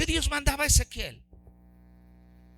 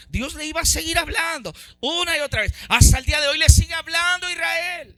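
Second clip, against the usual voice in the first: rising into full-force preaching, louder; 4 semitones higher, 4 dB louder than usual. Acted. Preacher exhorting the church, full-force preaching. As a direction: pound the congregation with conviction.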